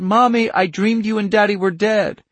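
put-on high, loud child crying out from a nightmare; frightened cry. Child calls to her mother after a nightmare, frightened. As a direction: wake up terrified and call for mommy. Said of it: "and she had cried".

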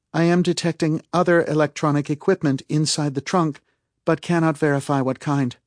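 high-pitched, even status report; Calpurnia reporting. A narrator's reading. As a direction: report the findings precisely, pitched well up. Narrator voices Calpurnia's report.

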